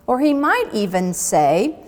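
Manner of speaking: ordinary delivery, nothing marked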